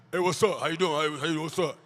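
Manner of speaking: low, puffed-up tough-guy greeting; alpha male. Acted. Coach in a too-small shirt greeting people, low macho voice. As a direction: drop the voice to sound buff. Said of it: "I'm the alpha male".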